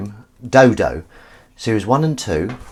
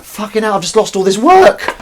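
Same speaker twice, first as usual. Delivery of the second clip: high, loud outburst; re-enacted frustrated yell; retold exasperation; 9 dB louder than usual. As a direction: cry out over lost work. Retold outburst over lost work.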